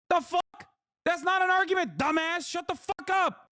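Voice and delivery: high, exasperated retort; mocking the guest's argument, high and irritated; angry outburst. Real, not acted.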